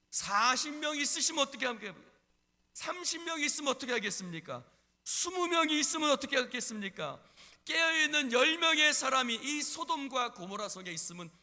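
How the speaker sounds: high, loud, insistent questioning; pleading prayer. Acted. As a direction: keep pressing God for mercy. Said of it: "아브라함이 하나님 앞에 기도했습니다". (높고 크게 거듭 호소하는 기도)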